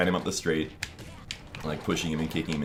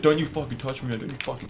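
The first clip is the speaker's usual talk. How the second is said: imitating a worked-up kid, higher pitched; mock-agitated, high-pitched protest; retold agitated protest; 7 semitones higher than usual. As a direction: act out a kid getting wound up. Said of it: "he starts getting really excited".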